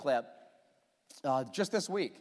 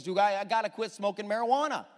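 usual speaking voice

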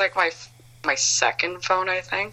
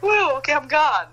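playful high-pitched takeoff cheer; excited whoop; 10 semitones higher than usual. Retold or imitated. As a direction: toss off a giddy high "woo" as if flying away. Leaving town on a plane.

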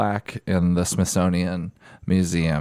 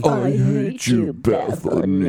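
slow mock; 3 semitones higher, slower than usual. Mocking insult to Bethany.

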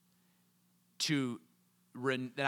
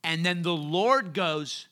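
usual speaking voice

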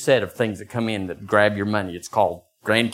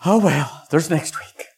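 teasing wife; 4 semitones higher than usual. Retold or imitated. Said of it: "she said".

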